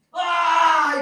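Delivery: high, loud cry of anguish; loud anguished shout. Acted. Preacher showing the congregation Paul's cry, a loud shout. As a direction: let out a sudden loud wail. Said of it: "이거예요".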